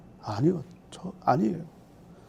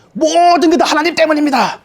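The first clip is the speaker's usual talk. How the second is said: higher, accusing plea; blaming God; 8 semitones higher than usual. Acted. Believer complaining to God, higher and accusing. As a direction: throw all the blame onto God. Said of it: "그런 느낌으로 말하고 있는".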